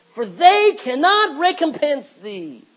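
each word weighted, slow and loud; slow emphatic preaching. Real, not acted. Preacher to his congregation, slow forceful emphasis. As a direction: lean hard on every word. Preacher pressing a scripture point.

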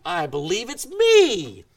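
loud, slow, laughing through I believe it's me; joyful laughing confession. Pretend. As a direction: laugh with relief as you claim it.

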